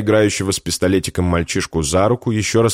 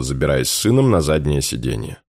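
plain reading voice

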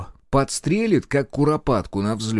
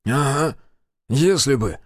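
contemptuous scoff, slightly raised pitch; scornful huff; 4 semitones higher, 3 dB louder, slower than usual. A narrator's reading. Major scoffs at the healer's suggestion, scornful. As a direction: dismiss it with scorn. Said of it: "презрительно хмыкнул Комаров".

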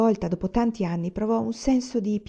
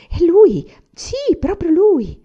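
much higher, loud excited cry; exclaiming; 10 semitones higher, 8 dB louder, slower than usual. A narrator's reading. Woman at her doorway recognising Anania, loud excited exclamation. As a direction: call out in amazed recognition. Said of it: "sente esclamare".

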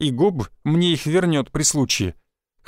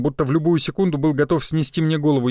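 usual speaking voice

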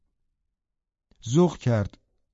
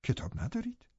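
high, astonished question, softer; in surprise; 8 semitones higher, 13 dB quieter than usual. Acted. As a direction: pitch it up in disbelief. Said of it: "با تعجب گفتم". (با تعجب و صدای زیر)

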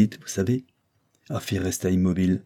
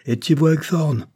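high, raised stern question; outburst; 7 semitones higher, 4 dB louder than usual. A narrator's reading. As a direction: demand an answer, pitch well up. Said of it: "avec un grand éclat de voix". (question sévère, voix haute)

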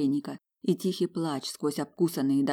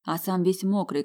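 ordinary delivery

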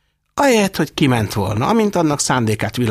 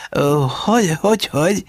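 higher, eager stammered hogy; eager asking; 7 semitones higher than usual. A narrator's reading. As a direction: press for the answer eagerly. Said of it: "élénk érdeklődéssel". (élénk érdeklődéssel)

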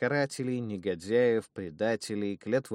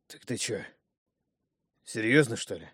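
hoarse. Acted. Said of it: "вмиг осипшим голосом переспросил второй".

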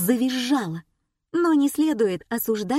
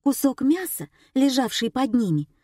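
usual speaking voice

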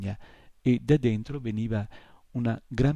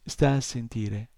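ordinary delivery

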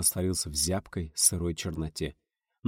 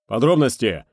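loud gruff bark; 3 semitones higher, 9 dB louder than usual. Acted. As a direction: snap the order out. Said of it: "рявкнул боцман". (рявкнуть)

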